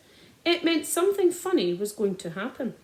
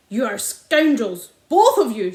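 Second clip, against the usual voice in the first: shouted insult; 9 dB louder than usual. A narrator's reading. Landowner shouting insults at father and doctor. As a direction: hurl it at both men. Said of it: "shouted Mister Hazel".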